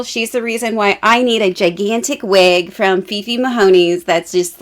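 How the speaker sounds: ordinary delivery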